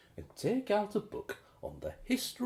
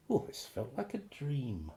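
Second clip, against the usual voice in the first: ordinary delivery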